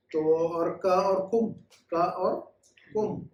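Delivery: slow, drawn-out Arabic endings for students; slow teaching demo. Shown as a demonstration. Teacher slowly models Arabic endings for the class. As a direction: spell out का and कुम slowly. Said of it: "तो ये देखिएगा".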